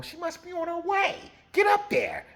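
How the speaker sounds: 高めの声で命令する年上の男を軽く演じる; 上に行けと急かすように高めに; bossy older man. Retold or imitated. Man sends boy upstairs to wait.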